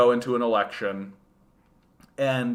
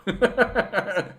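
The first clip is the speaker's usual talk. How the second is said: laugh; 7 semitones higher than usual. Real, not acted. Laughs at chat.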